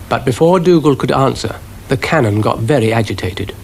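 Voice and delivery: usual speaking voice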